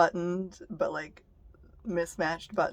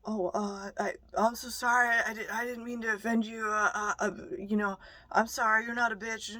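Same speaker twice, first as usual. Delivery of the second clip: higher, stammering mock apology, lightly voiced; mock groveling; 4 semitones higher than usual. Mocking the man who called her names, stammering. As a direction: play the grovelling creep, stuttering sorry. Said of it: "this little fucking slime bag".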